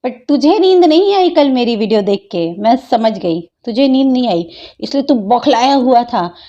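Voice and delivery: ordinary delivery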